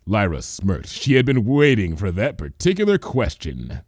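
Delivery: ordinary delivery, nothing marked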